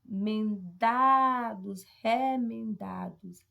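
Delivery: "Remendados" very slow, DA loud and stretched; stress test. Shown as a demonstration. Teacher quizzing the class, "Remendados" drawn out with DA stressed. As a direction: sound out "Remendados" syllable by syllable, swelling on DA. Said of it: "E aí, gente?".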